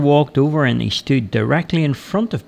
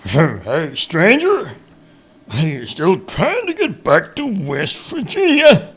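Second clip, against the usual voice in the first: higher-pitched backwoods hail; teasing woodcutter; 4 semitones higher, slower than usual. A narrator's reading. Woodcutter greeting the returning stranger, teasing character voice. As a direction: call to the traveller in a hillbilly voice.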